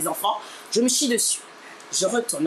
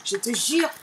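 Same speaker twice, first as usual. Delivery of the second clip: ordinary talk, nothing marked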